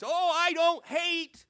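squealed high and very loud, drawn out slowly; a hammed-up protest of innocence; mock pious denial. Preacher mocking a hypocrite, high squealing protest.

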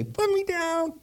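tiny high-pitched candle voice; little voice. Acted. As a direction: squeak it out like a very small candle. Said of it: "this little voice say".

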